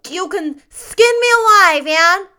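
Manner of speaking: put-on very high, loud child voice; Davy, fervent vow. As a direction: offer it with dramatic childish fervor. Davy proposes his own penance.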